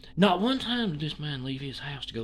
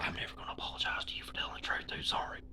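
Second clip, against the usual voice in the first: hushed aside; whisper; 11 dB quieter, faster than usual. Real, not acted. Telling Square he won't apologize, whispered. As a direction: whisper it under your breath.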